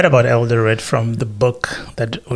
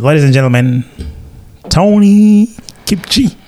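announcer voice; 5 semitones higher, 3 dB louder than usual. Acted. Introducing the podcast guest.

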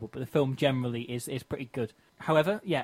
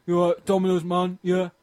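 louder, higher mock delivery-driver voice; pizza delivery guy; 5 semitones higher, 6 dB louder than usual. Redoing the phone call on request, hammed-up delivery guy. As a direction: imitate a Domino's man phoning up. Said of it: "a way that they actually say it".

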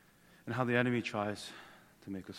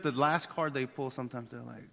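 ordinary talk, nothing marked

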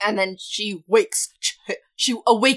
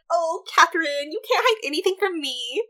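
lightly higher, coy sing-song; a hint of coy; 8 semitones higher than usual. Aunt teasing Catherine, a hint of coy sing-song. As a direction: tease her knowingly, a hint of coy. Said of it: "And she's like".

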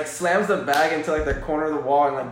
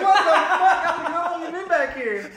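high, slow, laughing mock alarm; retold startled shout; 12 semitones higher, slower than usual. Telling friends about the stranger behind the dumpster, high laughing mock fright. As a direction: squeal it like you just got the fright of your life.

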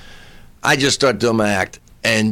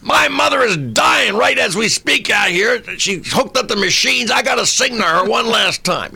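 melodramatic plea; 9 semitones higher than usual. Retold or imitated. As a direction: beg like it's life or death. New Yorker pleading to sing.

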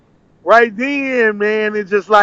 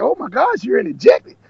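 higher-pitched retold gasp of surprise; mock amazement; 8 semitones higher than usual. Woman reacting to the speaker's greeting, amazed. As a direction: imitate her amazed, high-pitched reaction.

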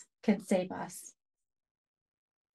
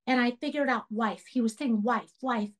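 ordinary delivery

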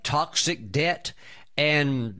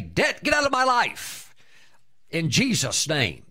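commanding rebuke; 3 semitones higher than usual. Shown as a demonstration. Preacher shows how to rebuke debt, commanding shout. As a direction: order the debt out like you mean it. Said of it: "They never say".

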